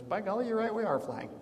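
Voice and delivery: high, wide-eyed "by golly"; gee-whiz naive wonder; a hint of a dopey discovery; mock amazement. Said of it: "Dante looks around and goes".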